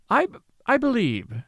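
halting, unsure start with a stumble; lame stammer. A narrator's reading. Young man handing back her olive, stammering lamely. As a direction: trip over the first word, losing nerve. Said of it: "he said lamely".

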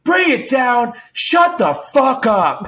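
high, slow mimicking roar; mock furious shout. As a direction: throw it back as a retold angry yell. Engineer yells back at the boss.

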